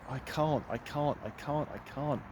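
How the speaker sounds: softly voiced self-doubt; quiet, lightly acted hopelessness; acted despair. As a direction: repeat it small and soft, as if giving up. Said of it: "you might be feeling like".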